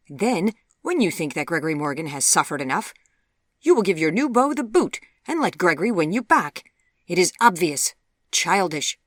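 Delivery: loud, forceful dressing-down; angry rebuke. A narrator's reading. Mother shaming her daughter for using a suitor, angry. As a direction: berate her as an outraged mother.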